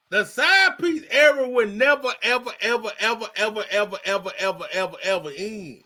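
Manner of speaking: EVER chanted over and over, sinking in pitch; long falling run of EVER; emphatic repetition. Real, not acted. Co-host answers with repeated ever.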